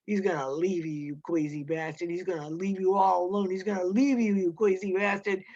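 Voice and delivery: ordinary delivery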